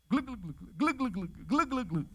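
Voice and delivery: mock drowning gurgle, quiet and bubbly; playful sinking gurgle; gurgling sounds. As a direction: gurgle like a man going under. Pedro sinking under the water.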